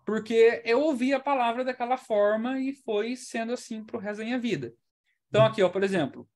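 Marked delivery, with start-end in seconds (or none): none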